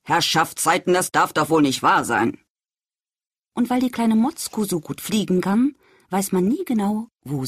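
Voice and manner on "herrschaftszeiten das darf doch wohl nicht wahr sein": dad calling out. Acted. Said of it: "Und Papa ruft".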